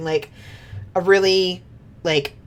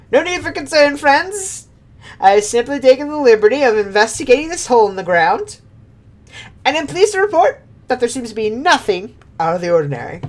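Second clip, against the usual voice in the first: high, fast, self-important reassurance; pompous gentleman; 6 semitones higher, 3 dB louder, faster than usual. Acted. Inspector reassuring friends after falling, pompous and quick. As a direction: cover up the blunder grandly.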